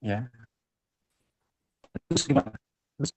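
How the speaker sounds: choppy broken-up call audio; radio breaking up. Real, not acted. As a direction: voice dropping out mid-sentence. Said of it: "Suaranya tadi putus-putus".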